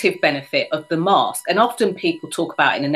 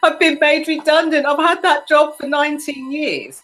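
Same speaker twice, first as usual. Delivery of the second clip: imitating upset friend; 10 semitones higher than usual. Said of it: "she said".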